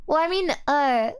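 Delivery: ordinary talk, nothing marked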